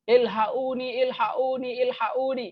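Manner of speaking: high, drawn-out cry for help in Egyptian Arabic; Egyptian-style call, qaf sounded as a glottal "a"; Egyptian Arabic plea. Shown as a demonstration. Teacher demonstrating the Egyptian cry for help, Egyptian Arabic plea. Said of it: "dalam bahasa orang Mesir".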